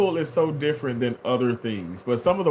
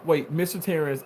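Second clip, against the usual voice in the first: ordinary delivery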